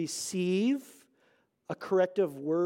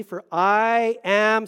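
very slow, louder build-up; drawn-out lead-in; 2 semitones higher, 8 dB louder, much slower than usual. Real, not acted. To the congregation, a slow loud lead-in. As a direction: stretch it out so they can join.

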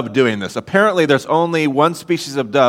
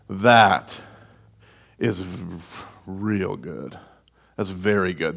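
low, soft, awed murmur; hushed awe; 7 semitones lower, 8 dB quieter, slower than usual. Retold or imitated. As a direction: hold it quiet, in wonder at his creation. God awed at humanity, hushed.

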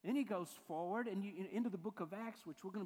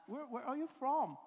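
higher, louder mock English accent; 6 semitones higher, 6 dB louder than usual. Preacher retrying his impression of the locals, English accent. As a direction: imitate the English, voice lifted. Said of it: "That's my English accent".

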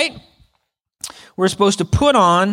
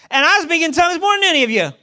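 And I speak in tongues, higher and fast, bragging; boastful mic drop; 8 semitones higher, faster than usual. Retold or imitated. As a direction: toss it off as a cocky brag. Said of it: "And then he's like".

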